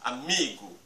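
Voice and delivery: Amigo with the middle "mi" stressed; Amigo, middle syllable strong and long. Shown as a demonstration. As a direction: lean on "mi" in Amigo. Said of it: "mi, c'est la syllabe la plus forte".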